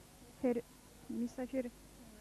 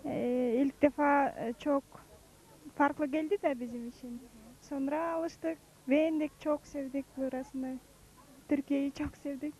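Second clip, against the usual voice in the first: Kyrgyz accent, careful learner's Turkish; halting Turkish with Kyrgyz accent; foreigner broken turkish; 5 dB louder than usual. Real, not acted.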